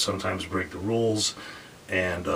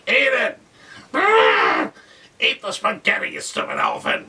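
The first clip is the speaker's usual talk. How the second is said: mock angry yell; 12 semitones higher, 6 dB louder than usual. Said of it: "And he's like".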